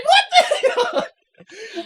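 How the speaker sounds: high, slow, laughing exclamation; hammed-up bewilderment; mock disbelief. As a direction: stretch it out in amused shock. Recalls own shocked reaction.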